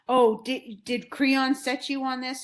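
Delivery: mock outburst, starting loud; retold angry accusation, only lightly heated; mildly angry accusation. Oedipus accuses the blind seer of plotting, mildly angry. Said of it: "loses his mind".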